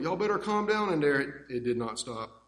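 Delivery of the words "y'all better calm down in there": raised mock yell from the other room; retold yell. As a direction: call out to the kids, half loud. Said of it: "Pop's yelling".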